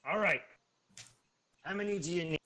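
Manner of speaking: a hobgoblin trader talking down his price; hobgoblin haggling. Acted. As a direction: hobgoblin merchant pitching up loud to close the deal.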